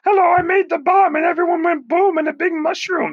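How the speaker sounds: a squeaky Oppenheimer voice; Oppenheimer impression. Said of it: "It's Doctor Oppenheimer".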